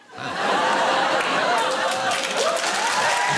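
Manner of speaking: big burst of laughter; loud laugh. Real, not acted. Big laugh at own line.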